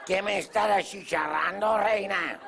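high, slow wail of protest; hammed-up plea, pitched high and drawn out; pained mock protest. Client being burnt begs beautician, high mock wail. Retold burning by hot wax.

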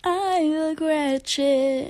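high, slow drawn-out whine; dramatic whine. Pretend. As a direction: stretch it out in mock despair.

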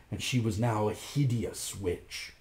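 ordinary delivery, nothing marked

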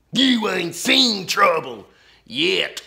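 loud, very slow threatening drawl; menacing outlaw. A narrator's reading. Ten Gun Ben threatens Laura's dad, slow and loud. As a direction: draw out the threat.

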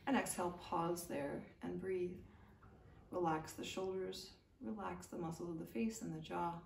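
gentle yoga teacher cueing the breath; guided meditation. Acted. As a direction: lull them through the neck rolls. Guided neck rolls with breathing.

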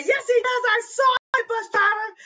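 put-on very high, loud insistent old woman; old lady insisting. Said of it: "she said".

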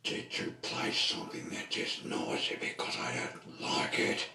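whispery, rasping telling-off; disapproving complainer. Acted. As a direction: scold them in a voiceless rasp. Figure complains about the noise.

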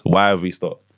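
usual speaking voice

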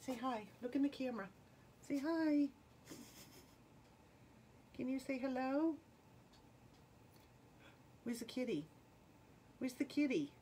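high, coaxing pet voice; talking to a pet. Real, not acted. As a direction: sweet-talk the cat into coming over. Coaxing cat to the camera.

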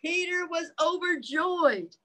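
put-on lively read-aloud voice for children; reading the book to little listeners; story narration. A narrator's reading. Parent reading a picture book to children, lively read-aloud voice.

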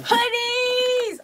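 loud, high excited cry; excited shout. Real, not acted. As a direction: shout it out with joy. Opening the gift hoodies.

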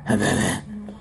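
low, slow Batman growl; Dark Knight Batman. Acted. [name] doing Batman for his viewers, low slow growl. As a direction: grind out the line like the Dark Knight. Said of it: "My first impression is going to be Batman".

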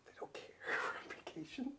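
laughing whisper aside. Real, not acted. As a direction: whisper back, stifling a laugh. Whispered reply during call.

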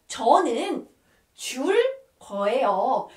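"저는 줄 거예요" split by short pauses; teacher's careful model of "저는 줄 거예요", word by word; piece-by-piece demonstration. Shown as a demonstration. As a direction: say "저는", "줄", "거예요" one at a time. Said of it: "when you want to say".

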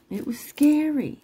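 high-pitched, emphatic SCARY; high, voiced insistence on "scary"; voiced exclamation. Real, not acted. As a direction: pitch it way up, then drop at the end. Says it twice, contrasting takes.

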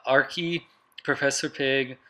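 usual speaking voice

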